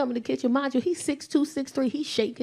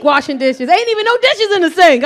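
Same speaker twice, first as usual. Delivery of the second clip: ordinary talk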